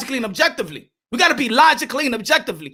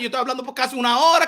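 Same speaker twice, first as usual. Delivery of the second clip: ordinary talk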